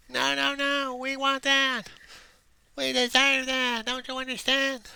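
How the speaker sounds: very high, loud mock wail; squealed, insistent pleading in a put-on voice; mock yelling at God. People yelling at God or angels, high mock screaming. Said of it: "probably yelling and screaming at God".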